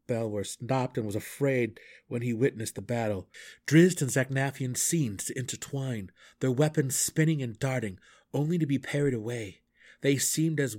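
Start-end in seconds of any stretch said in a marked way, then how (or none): none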